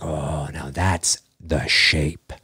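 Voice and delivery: low, slow, hushed voice; hushed awe. Retold or imitated. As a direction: breathe it out in awe. Imagined audience reaction.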